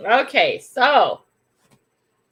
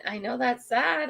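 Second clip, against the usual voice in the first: ordinary talk, nothing marked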